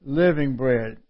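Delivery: "Living" leaned on, falling at the end; stress on "Living". Shown as a demonstration. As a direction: dwell on the word "Living". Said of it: "I emphasize living".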